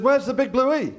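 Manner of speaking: high, startled cry of disbelief; alarmed question. Retold or imitated. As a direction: yelp it as the panicking son. Son panics at deleted internet.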